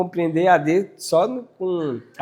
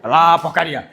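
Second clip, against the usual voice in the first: short, rough put-on rebuke; scolding a dog; 2 semitones lower, 4 dB louder than usual. Shown as a demonstration. Showing how a dog reacts to tone, scolding. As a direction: send the dog off with a curt scold. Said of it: "Quando tu fala assim, ó".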